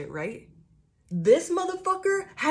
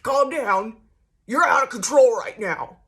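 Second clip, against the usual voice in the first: louder, higher scolding imitation; mock scolding; 4 semitones higher, 9 dB louder than usual. Imitating people scolding her, hammed-up loud. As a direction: scold like a condescending authority. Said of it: "they're like".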